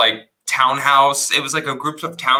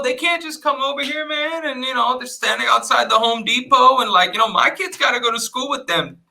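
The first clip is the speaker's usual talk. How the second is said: much higher, put-on voice of a ranting neighbor; mock neighbor rant; 11 semitones higher than usual. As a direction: impersonate the annoyed neighbor complaining. Imitating neighbors ranting about immigrants.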